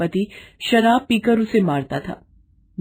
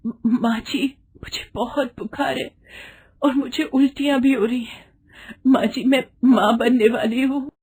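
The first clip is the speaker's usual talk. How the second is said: sick pleading bride; 6 semitones higher than usual. Acted. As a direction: plead weakly through the fever.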